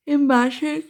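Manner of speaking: talking through a yawn; words stretched by a yawn. Real, not acted. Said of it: "sorry for the yawn".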